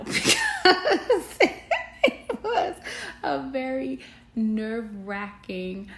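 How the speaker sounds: bursting into loud laughter mid-sentence; laughing. Real, not acted. Laughing at own wedding nerves.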